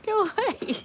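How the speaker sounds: high, giggly shooing; laughing shoo. Real, not acted. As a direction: shoo the dog away through laughter. Shooing the wet dog, between laughs.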